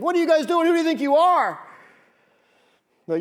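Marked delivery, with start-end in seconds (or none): 0.0-1.6 higher, louder outraged yell; mock outraged shout. Micah shouting after the raiders, mock outrage. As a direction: play Micah yelling in outrage, a bit hammed-up. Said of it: "shouting and screaming".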